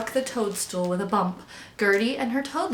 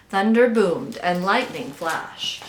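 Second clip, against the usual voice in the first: usual speaking voice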